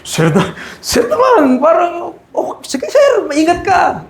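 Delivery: hammed-up officer fawning at a boss's name; mock name-dropping officer. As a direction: play the cop who suddenly turns friendly. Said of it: "Magbanggit ka ng pangalan".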